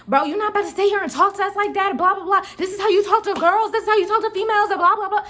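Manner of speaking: high, loud mock telling-off; imitating angry coworker. Coworker telling off the rude customer, hammed-up angry scolding. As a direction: scold him like a fed-up coworker. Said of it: "she's like".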